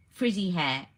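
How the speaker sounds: ordinary talk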